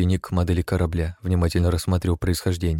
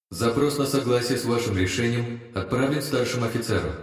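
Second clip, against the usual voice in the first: higher, softer ship computer voice; disembodied voice; 5 semitones higher, 3 dB quieter than usual. Acted. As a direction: speak as the ship's AI. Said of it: "голос продолжил".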